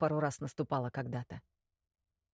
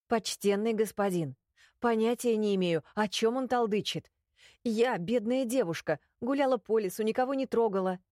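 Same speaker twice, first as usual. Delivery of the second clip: put-on higher, pleading innocent girl; 6 semitones higher than usual. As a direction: play the helpless innocent maiden. Heroine pleads innocence to inquisitor.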